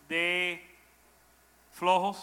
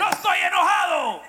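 high, loud yelled denial; ironic shout; 10 semitones higher, 5 dB louder than usual. Retold or imitated. Interpreter echoing the shouted denial. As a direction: shout that you're calm. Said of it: "Why are you screaming?".

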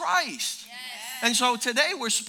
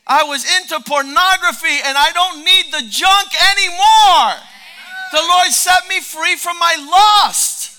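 shouted testimony, much louder, slow; husbands shouting back; 3 semitones higher, 12 dB louder than usual. Retold or imitated. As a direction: roar it out like a freed man.

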